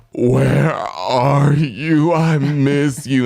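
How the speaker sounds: loud, high, slowly drawn-out mock pleading; pleading husband impression. Husband on speakerphone to wife, hammed-up pleading. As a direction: wail it out slowly like a clingy husband.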